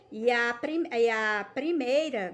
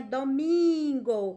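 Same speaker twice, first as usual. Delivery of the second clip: "domingo" with MIN held long and louder; stress on the MIN; 5 dB louder, much slower than usual. Shown as a demonstration. Teacher showing pupils the tonic syllable, "domingo" with a stretched MIN. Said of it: "estiquei mais".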